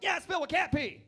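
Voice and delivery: loud high proud boast. Retold or imitated. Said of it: "I'm like".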